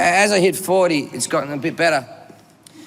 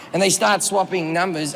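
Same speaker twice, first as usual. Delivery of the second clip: ordinary talk, nothing marked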